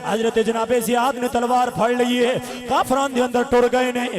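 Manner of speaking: loud, ringing sermon chant; full-force preaching. A narrator's reading. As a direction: preach the battle story at full volume. Preaches the battle story.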